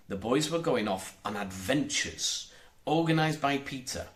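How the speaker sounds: ordinary delivery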